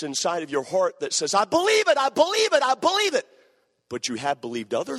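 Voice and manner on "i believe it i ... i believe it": high, loud repeated insistence; mock zealous chant, high and loud; aggressive insistence. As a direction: hammer it like a stubborn zealot. Mimicking aggressive belief.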